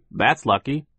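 high, loud, triumphant; Phil. A narrator's reading. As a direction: announce it like good news. Optimistic Phil continues.

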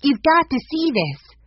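high, loud urgent insistence; breaking news, cried out high; excited cry. Acted. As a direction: urge them over excitedly. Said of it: "Violet cried".

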